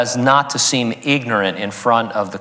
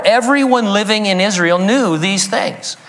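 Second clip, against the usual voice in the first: ordinary talk, nothing marked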